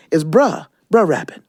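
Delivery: "bruh" short with no r at the end; Southern "bruh". Retold or imitated. Southerners correcting her, short "bruh rabbit". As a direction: say "bruh rabbit" clipped and quick. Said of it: "You saying it wrong".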